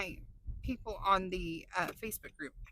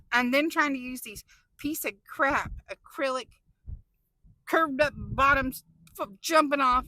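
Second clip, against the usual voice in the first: frustrated rant; 2 semitones higher, 7 dB louder than usual. Real, not acted. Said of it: "I'm just so angry".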